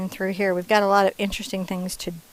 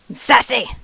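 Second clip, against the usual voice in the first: sassy; 4 semitones higher, 6 dB louder than usual. Acted. Painting a sassy rooster.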